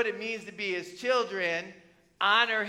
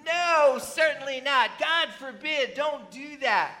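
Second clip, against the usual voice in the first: higher and louder, a forceful "no, certainly not"; preacher forcefully answering the question, higher and louder; emphatic refusal; 6 semitones higher, 5 dB louder, slower than usual. Acted. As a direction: answer firmly from the pulpit, raised voice.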